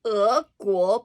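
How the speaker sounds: "guo" said loud and clear in Chinese; Chinese syllable. Shown as a demonstration. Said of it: "It says".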